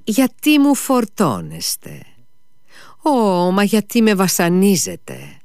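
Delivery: whining servant. A narrator's reading. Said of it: "είπε ο Σμερνιακόφ".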